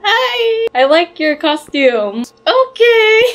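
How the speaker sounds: high, bright customer greeting; cheery ice cream seller. Pretend. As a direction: greet like a beaming shop clerk. Playing shop seller, smiling.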